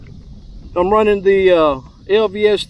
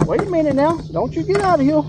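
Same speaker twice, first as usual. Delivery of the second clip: much higher, urgent half-joking warning; playful scolding; 8 semitones higher than usual. Real, not acted. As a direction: tell the fish off before it slips away. Angler warning a fish that's escaping.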